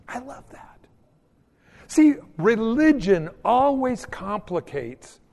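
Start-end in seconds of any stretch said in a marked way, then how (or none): 0.1-0.8 breathy hushed "I love that"; whispered aside. Real, not acted. Pastor to congregation, a whispered aside. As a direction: say it under your breath, moved.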